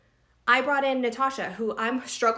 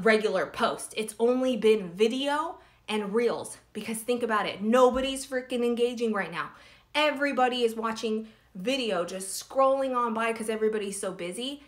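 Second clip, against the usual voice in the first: usual speaking voice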